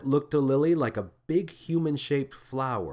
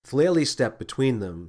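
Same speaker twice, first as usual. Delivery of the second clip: ordinary delivery, nothing marked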